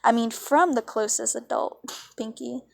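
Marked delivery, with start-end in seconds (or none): none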